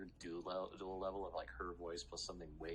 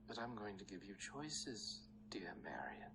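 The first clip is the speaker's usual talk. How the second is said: menacing whisper; 2 semitones higher, slower than usual. Acted. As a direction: purr it with threat.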